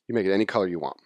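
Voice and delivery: normal talking voice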